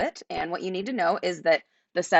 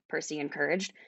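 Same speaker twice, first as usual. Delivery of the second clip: ordinary delivery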